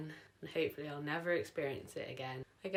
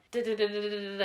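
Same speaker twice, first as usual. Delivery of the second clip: rhythmic heartbeat imitation; 4 semitones higher, 5 dB louder, much faster than usual.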